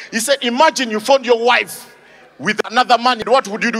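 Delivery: usual speaking voice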